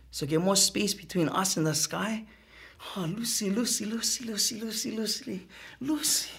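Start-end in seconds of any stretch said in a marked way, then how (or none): 2.9-6.3 soft. Acted. Softly calling her name repeatedly.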